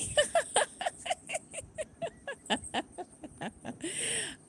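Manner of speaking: high, drawn-out peals of laughter; long laugh. Real, not acted. Streamer teasing a friend over lost subscribers, long high laugh.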